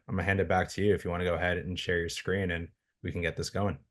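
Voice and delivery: usual speaking voice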